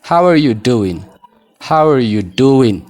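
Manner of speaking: "How are you doing" linked, ending "doowin"; model pronunciation. Shown as a demonstration. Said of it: "G jedhiinaa ishee kana". ("How are you doing" gaa malee shaakaluu)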